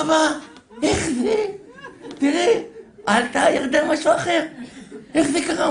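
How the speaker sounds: put-on high, loud, wide-eyed child voice; amazed boy. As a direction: play the son astonished by the elevator. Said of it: "הילד אמר". (ילד משתומם בקול גבוה)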